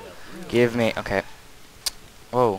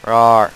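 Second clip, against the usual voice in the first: loud playful battle roar; mock roar; 13 dB louder than usual. Gamer roaring at zombies while shooting, loud mock roar. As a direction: roar at the horde.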